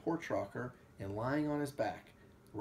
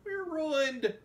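high, loud theatrical wail; 6 dB louder than usual. A narrator's reading. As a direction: wail it out in despair. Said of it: "wailed as she held her head".

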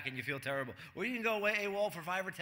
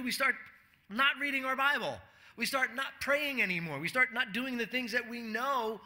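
preacher driving the congregation toward the point; fiery preaching; 6 semitones higher than usual. Acted. Pastor warns church about bitterness, in a raised, pressing preaching voice. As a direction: pitch raised well up, pressing each line home.

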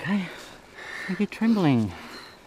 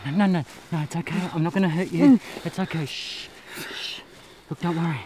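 normal talking voice

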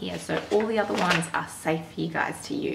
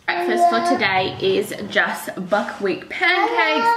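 high excited; 9 semitones higher, 6 dB louder than usual. Real, not acted. Toddler crying in background.

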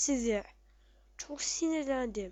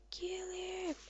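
hushed urgent kill him; whisper; 6 semitones higher, 11 dB quieter than usual. Real, not acted. Player whispering to teammates to take out the target, hushed.

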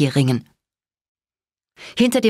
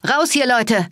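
higher-pitched urgent call; contained shout to a crowd; 7 semitones higher, slower than usual. A narrator's reading. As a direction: order everyone out, pitch raised. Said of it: "rief ich".

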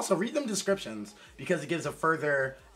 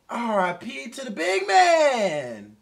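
slow loud mock eulogy; 7 semitones higher, 7 dB louder, much slower than usual. Real, not acted. Mock mourning tribute.

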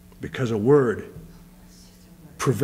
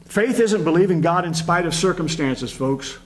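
raised, rising preacher's call to the congregation; preaching at full force; 4 semitones higher than usual. Real, not acted. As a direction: build it up and lift the pitch as the church answers.

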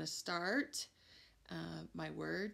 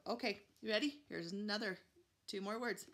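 ordinary delivery

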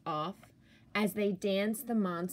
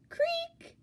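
creak. Acted. Sound effect in story.

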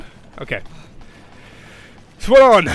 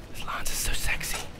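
soft admiring whisper; 11 dB quieter than usual. Real, not acted. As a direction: breathe it lovingly. Admiring weapon.

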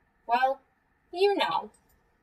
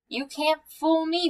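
slow, higher, wavering challenge; uncertain growl; 7 semitones higher, 3 dB louder than usual. A narrator's reading. Bear alone with strange noises, uncertain growl. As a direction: growl it without sounding very certain. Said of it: "Bear growled".

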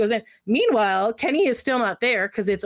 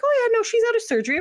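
high, quick, offhand reply; fast and pitched way up; breezy staff imitation; faster than usual. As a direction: imitate a nurse tossing out news. Staff casually give news, retold.

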